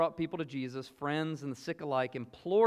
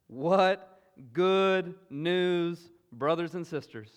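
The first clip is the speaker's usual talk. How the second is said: very slow and much louder, drawn out; fervent proclamation; 3 semitones higher, 9 dB louder, much slower than usual. Real, not acted. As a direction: let the good news ring out over the congregation. Preacher's joyful climax.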